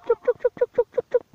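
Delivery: high, sing-song chicken call. Real, not acted. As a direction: call the chooks in, high and repeated. Calling the chickens again.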